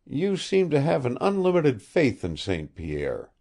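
ordinary delivery, nothing marked

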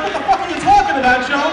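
very loud, incredulous demand; retold outburst at a nonsense speech; baffled heckle. Meeting member calling out Joe's nonsense, loudly baffled.

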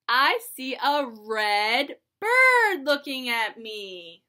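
singsong picture book reading; lilting read-aloud for little kids; reading to a toddler. Acted.

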